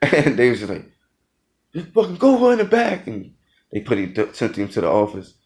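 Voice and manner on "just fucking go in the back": high, loud exasperated order; imitated drill instructor yell. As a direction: bark it like a fed-up drill instructor.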